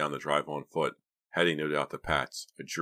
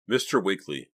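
usual speaking voice

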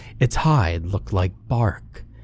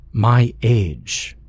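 plain reading voice

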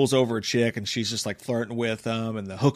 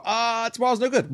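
hesitant brush-off; 10 semitones higher than usual. Retold or imitated. Michael dodging the invitation.

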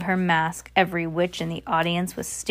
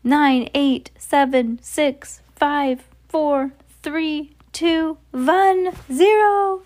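high count climbing to a loud zero; building countdown; 8 semitones higher, 4 dB louder, much slower than usual. Acted. Grand High Witch counting down to transformation, rising high cry. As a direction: raise the pitch step by step to zero.

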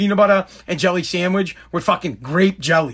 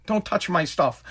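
quiet plea; 2 semitones lower, 6 dB quieter than usual. Real, not acted. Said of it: "repeated plea".

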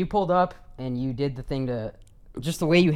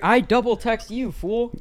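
higher, slowed teasing taunt; 9 semitones higher, 3 dB louder, slower than usual. Acted. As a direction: draw it out high to tease. Teasing friend over messages.

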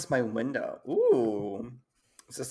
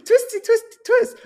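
singsong mock; 9 dB louder than usual. Real, not acted. Mocking the story's twist.

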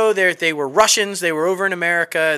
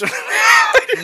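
high, loud burst of laughter; 3 dB louder than usual. Real, not acted. Laughing at own Ben Affleck joke, high burst of laughter. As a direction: crack up at the joke.

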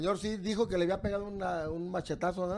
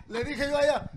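usual speaking voice

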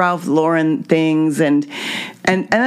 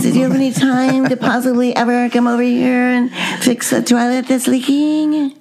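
high, sing-song mock phone voice; imitation de Kelly au téléphone; 6 semitones higher than usual. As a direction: impersonate an over-polite caller, pitched well up. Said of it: "let me do it for you".

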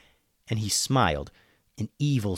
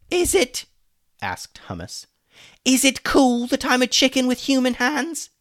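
indignant; 6 dB louder than usual. Acted. Hummus indignant.

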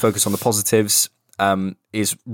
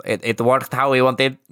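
slow, higher Spanish accent; 4 semitones higher than usual. Shown as a demonstration. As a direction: Unai Emery's Spanish accent. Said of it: "I was gonna do a Spanish accent".

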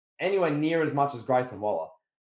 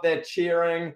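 ordinary talk, nothing marked

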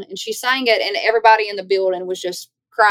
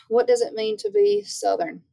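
surprised echo; 12 semitones higher than usual. Real, not acted. Repeats host's question, surprised.

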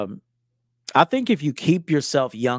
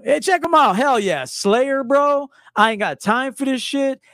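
much higher and louder, pumped; hyped metalhead shout; 11 semitones higher, 4 dB louder than usual. Retold or imitated. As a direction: yell it like a fan at a show. Hyped metalhead bit again.

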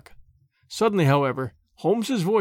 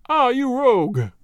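higher, louder, slow, hearty exclamation; delighted cry; 6 semitones higher, 4 dB louder, slower than usual. A narrator's reading. Jones delighted to find the disguise was Holmes, hearty cry. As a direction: crow with delight at being fooled. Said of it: "cried Jones, highly delighted".

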